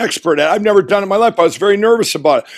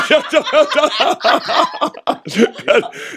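high rising laugh at his own joke; 7 semitones higher than usual. Real, not acted. Laughing after telling the reporters his song twist. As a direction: laugh it off, pleased with himself.

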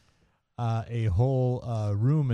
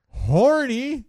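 loud emphatic read; 9 dB louder than usual. Retold or imitated. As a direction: belt out the word horny, high and loud. Reading the note's big word.

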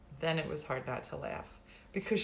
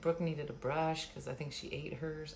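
ordinary delivery

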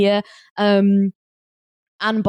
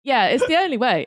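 ordinary talk

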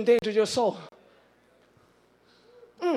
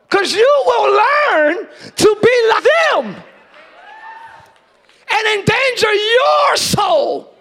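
loud, high and slow; preached shout; 10 semitones higher, 8 dB louder, much slower than usual. Acted. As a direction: stretch and shout each word of the warning. Preached climax.

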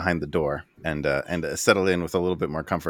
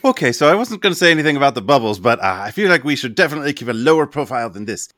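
quick, high, loud character voice; Riggs; 6 semitones higher, 7 dB louder, faster than usual. Acted. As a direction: rattle it off urgently. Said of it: "Riggs rolls up".

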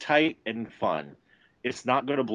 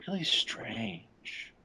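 soft unvoiced whisper, slow; slow whispered aside; 5 semitones higher, 10 dB quieter, much slower than usual. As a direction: breathe it out as a conspiratorial secret. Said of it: "he's like".

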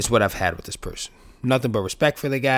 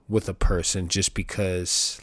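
ordinary delivery

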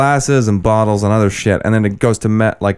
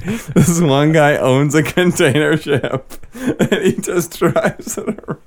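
laughing; 7 semitones higher, slower than usual. Real, not acted.

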